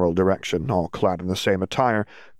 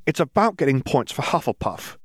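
higher-pitched, assertive retort; 6 semitones higher than usual. A narrator's reading. Ginny argues for Hufflepuff points, assertive. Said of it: "Ginny asserted".

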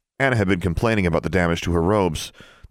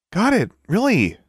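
much higher, gushing schoolgirl voice; bubbling over with delight; excited Sonia; 3 dB louder than usual. Acted. Sonia gushes to Alexandra about the ritual, high and thrilled.

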